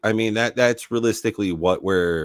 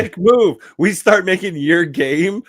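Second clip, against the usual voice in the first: high, loud, laughing exclamation; laughing jab; 11 semitones higher, 7 dB louder than usual. Real, not acted. Co-host ribbing the others over a joke, laughing and loud. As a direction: crow it through laughter, pitched way up.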